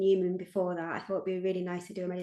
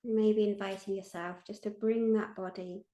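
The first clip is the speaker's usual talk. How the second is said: guided meditation; 3 dB quieter than usual. Acted. Meditation leader invites listeners to feel held, guided meditation. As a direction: lead listeners gently inward, leaving space after each phrase.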